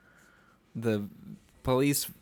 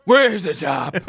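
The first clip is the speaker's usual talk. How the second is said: higher, loud gravel-voiced bark; Batman yell; 6 semitones higher, 8 dB louder, slower than usual. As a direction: roar it like a mock Batman. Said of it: "just yelling".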